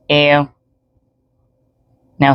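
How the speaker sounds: usual speaking voice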